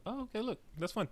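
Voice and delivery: very high, soft little reaction; light, high-pitched murmur of discovery; pleasant surprise. Retold or imitated. Co-host re-enacting spotting cast surprises, pleasantly surprised. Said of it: "nice couple of surprises in the cast".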